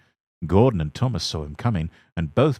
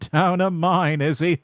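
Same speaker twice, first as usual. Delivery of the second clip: high, loud gloating; big engine gloating over Thomas; mocking glee; 10 semitones higher, 4 dB louder than usual. A narrator's reading. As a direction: relish the other's trouble.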